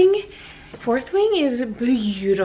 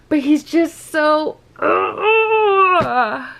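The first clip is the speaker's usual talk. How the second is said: higher, louder swooning cry; swooning gush; excited squeal; 5 semitones higher, 6 dB louder, much slower than usual. Real, not acted. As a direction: overcome with fangirl delight. Gushing over a character.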